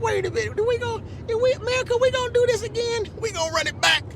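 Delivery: high, fast, stunned "America, we gonna do this again?"; stunned disbelief. Retold or imitated. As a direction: act out the shock of a rerun election. Acting his stunned second reaction.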